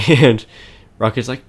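ordinary talk, nothing marked

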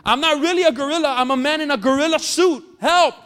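high, loud screams for help; frantic yelling, voice cracking high; panicked screaming. Acted. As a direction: scream like a man facing a lion. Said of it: "screaming and yelling".

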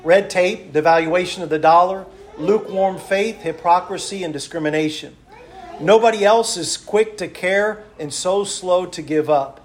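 loud, rhythmic pulpit delivery, listing each item; fervent preacher's list; preaching. Acted. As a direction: preach it to the congregation.

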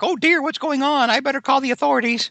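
put-on high-pitched fretful old aunt; Aunt May. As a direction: put on a high, fussy auntie voice. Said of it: "and Aunt May's like".

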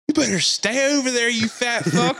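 high-pitched mock threat; imitated yelling. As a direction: yell a warning across the street as the angry woman. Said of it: "she's yelling the whole time".

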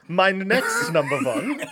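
put-on slow laughing German accent; mock German accent. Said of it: "Did you do a German accent then?".

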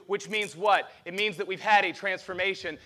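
usual speaking voice

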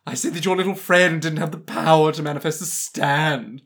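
lightly put-on British accent, smug; British villain. Friend mocks the anime villain, in a light British accent. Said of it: "why have I become more and more British".

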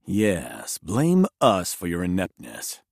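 disdainful envoy, low and level. Acted. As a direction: sneer at the headmaster. Monk envoy answers scornfully.

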